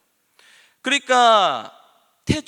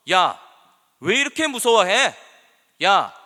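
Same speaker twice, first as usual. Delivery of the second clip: loud, raised-pitch imitated telling-off; 예수님; 3 semitones higher, 7 dB louder than usual. Preacher acting Jesus rebuking scared disciples, loud scolding. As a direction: scold them bluntly, voice raised, as a quote. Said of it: "라고 하면서 꾸짖으셨다니까".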